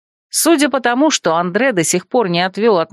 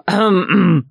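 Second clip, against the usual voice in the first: throat clearing. Acted.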